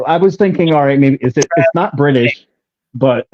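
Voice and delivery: ordinary talk, nothing marked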